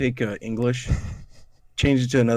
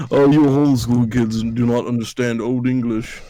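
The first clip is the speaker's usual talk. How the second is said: put-on condescending voice; mock condescending; 4 dB louder than usual. Mocking himself to a homeschooled co-host, hammed-up condescension. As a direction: talk down to them in an exaggerated put-on voice. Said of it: "I sound like a huge condescending asshole".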